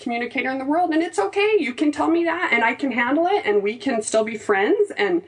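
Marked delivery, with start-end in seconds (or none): 0.9-1.5 high, sing-song it's okay; high sweet reassurance to a tense husband; soothing. Retold or imitated. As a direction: croon it to calm him. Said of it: "I'm like".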